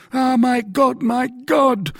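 very high, loud wail of despair. A narrator's reading. Poor mercer led away by guards, despairing. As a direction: lament in a high, stricken voice. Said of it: "murmured the poor mercer".

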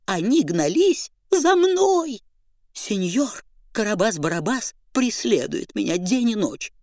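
high, scared, rushed begging; frightened pleading. Acted. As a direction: plead in terror to hide. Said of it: "пугливо оглядываясь".

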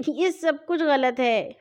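ordinary delivery

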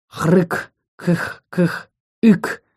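rasping coughs ending in a hiccup; coughing monster sounds. Acted. Monster coughs and hiccups at the little dragon. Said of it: "произнесла кашляющим звуком".

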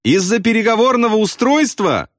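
very high disbelieving cry; incredulous shout. A narrator's reading. Pilot doubts bombardier's reason, incredulous shout. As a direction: question called out high, in disbelief. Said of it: "недоверчиво прокричал Кроха Сэмпсон".

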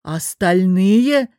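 drawn-out loud boss's roar. A narrator's reading. Boss roaring at his employee over the phone, roar. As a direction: bellow the word slowly. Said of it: "взревел охрипший от рёва босс".

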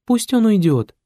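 high, tearful plea; hurt, weepy sweetheart; almost crying girl. A narrator's reading. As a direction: whine it in a woman's high voice. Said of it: "уже почти плакала девушка".

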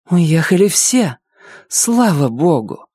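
slow, high sigh of relief; relieved. Acted. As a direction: let all the fear go out. Vika's relief after danger.

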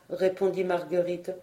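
plain reading voice

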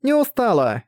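put-on high proud child's denial; cocky little girl; confident little girl.

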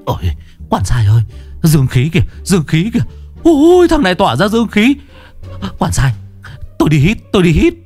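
a hungry spirit going wild over the scent; greedy excited ghost. Acted. Ghost excited by yang energy.

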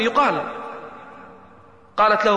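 ordinary delivery

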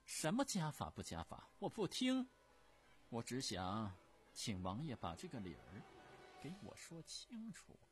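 a scoffing snort, then a sharp high refusal; scornful empress. Acted. Empress dowager dismissing the prince's family law, scornful and shrill. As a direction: wave away the rules with disdain.

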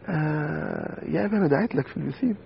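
put-on breezy, unbothered reply from the boy; imitating son. Son casually telling his father he prayed for him at the pool, lightly voiced. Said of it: "الولد قال لي".

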